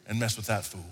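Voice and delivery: dad hurrying away from his guests; hurried aside to guests. Retold or imitated. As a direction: rattle off the excuse, then sink low. Dad excusing himself to guests.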